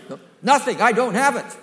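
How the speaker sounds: excited laughing shout; emphatic. Shown as a demonstration. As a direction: hammer the point home laughing. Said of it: "makes it emphatic".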